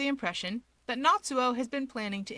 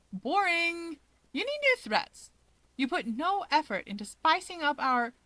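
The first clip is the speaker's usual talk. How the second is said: much higher, playful and mocking; teasing taunt; 6 semitones higher than usual. Keigo to Dabi, mocking his threats. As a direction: taunt him with glee.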